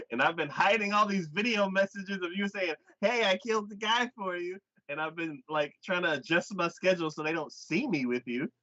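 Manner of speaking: mock exasperated rant. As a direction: vent as a fed-up woman's inner voice. Voices her exasperated inner rant.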